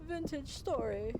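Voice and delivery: put-on loud, high, slow old English accent. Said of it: "I use my old English voice".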